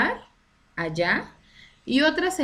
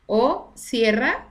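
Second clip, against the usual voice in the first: cierra stretched very slowly and louder, sierra sense; slow emphasis; 3 semitones higher, 4 dB louder, much slower than usual. Shown as a demonstration. Teacher contrasting the two meanings of cierra, very slowly. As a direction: drag out cierra deliberately. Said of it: "que es un conjunto de montañas".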